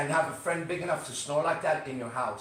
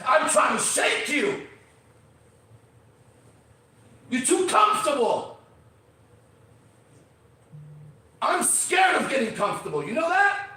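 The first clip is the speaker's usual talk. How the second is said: loud, much higher push to wake them up; challenging shout; 6 semitones higher, 4 dB louder than usual. Real, not acted. Host shouting at listeners to stop being comfortable, loud.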